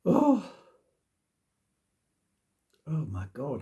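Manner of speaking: normal talking voice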